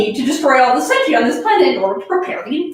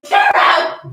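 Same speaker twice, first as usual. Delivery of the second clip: shouting; 5 dB louder than usual. Acted. Reading fanfic, character yells.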